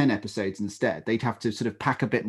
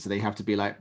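ordinary talk

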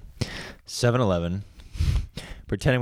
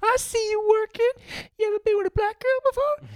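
put-on older woman voice. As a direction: tease him as an older lady, pitched up high.

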